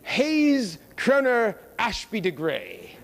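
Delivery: slow, laughing mock British accent; drawn-out put-on British accent; teasing the name in a hammed-up British accent. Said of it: "he adopted a British accent".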